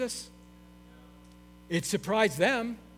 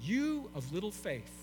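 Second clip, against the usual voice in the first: soft and unhurried, no scolding; gentle pastoral; 9 dB quieter than usual. Acted. Jesus answering the frightened disciples, soft and tender. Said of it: "how pastoral Jesus is".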